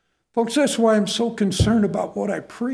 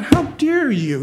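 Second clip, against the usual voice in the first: indignant parent; 7 semitones higher, 3 dB louder than usual. Retold or imitated. Parent defends child against insult.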